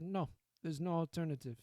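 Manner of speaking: attempted Italian mob-boss accent; rough go at an Italian accent; Italian mobster accent. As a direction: reassure like an Italian-accented Don. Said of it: "I can't do an Italian accent".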